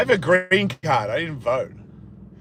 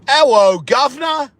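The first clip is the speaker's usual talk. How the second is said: loud, high hammed-up British greeting; mock British accent; 9 semitones higher, 4 dB louder than usual. Shown as a demonstration. Showing what British sounds like, exaggerated British accent. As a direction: call it out in a cartoon British accent. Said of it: "British is".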